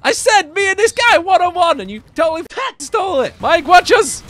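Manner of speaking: loud, high-pitched outrage; indignant yelling. Pretend. Gamer protesting to a teammate who stole the kill, indignant yell.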